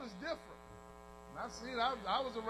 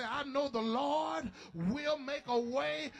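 proclaiming faith; 2 semitones higher, slower than usual. Acted. Quoting a believer's faith.

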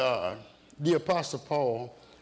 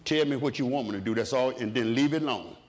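usual speaking voice

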